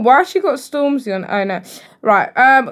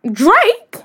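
high, loud, incredulous question; real shock, pitch shooting up; shocked disbelief; 10 semitones higher, 6 dB louder than usual. Real, not acted. Shocked at dating rumour.

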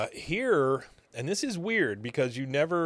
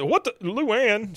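higher, drawn-out mock startle; startled outcry; 10 semitones higher, 4 dB louder, slower than usual. Uncle caught in bed by his niece, startled. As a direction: imitate a startled uncle catching someone. Startled Hank catches Luann in bedroom.

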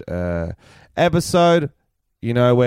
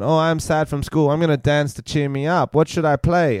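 lightly voiced girl, higher pitch; 6 semitones higher than usual. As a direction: imitate a girl's scripted line, pitched up. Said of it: "there's a girl on T V going".

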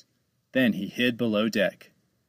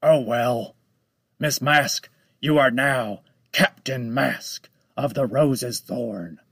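menacing shark voice. Acted. Shark shrugs off the drowning.

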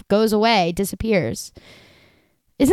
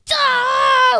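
high mock shriek of alarm; retold shriek; 3 dB louder than usual. Watching the battle live, sudden high mock shriek. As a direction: yelp it, watching the scene live. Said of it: "I just, like".

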